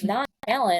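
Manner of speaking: "Nonchalant" as non-CHAL-ant, puzzled; puzzled mom repeating "Nonchalant" as non-CHAL-unt; echoed mispronunciation. Retold or imitated. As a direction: echo "Nonchalant" misread, ending like "gallant". Mother echoes the misreading.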